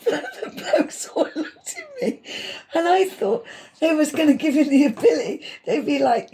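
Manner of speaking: high-pitched giggly telling, slowed down; can barely get the words out for laughing. Real, not acted. Telling friends the optician story, laughing.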